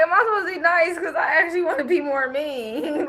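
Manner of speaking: laughing outburst. Retold or imitated. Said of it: "I'm like".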